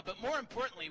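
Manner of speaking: ordinary talk, nothing marked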